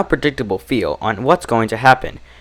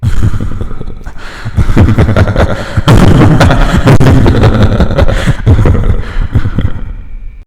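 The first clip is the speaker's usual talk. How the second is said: evil laugh; 3 semitones lower, 6 dB louder than usual. A narrator's reading. Evil laugh after horror ending.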